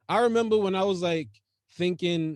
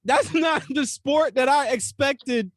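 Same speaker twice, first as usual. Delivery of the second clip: amused disbelief; 6 semitones higher than usual. Real, not acted. Amused disbelief, laughing, after cracking up.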